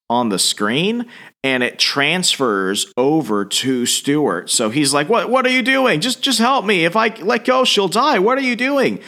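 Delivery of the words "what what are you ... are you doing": high, frantic acted panic; trapped man panicking as the alien suit takes him, much higher; panicked. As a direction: play it like the suit is grabbing you. Suit taking over, panicked.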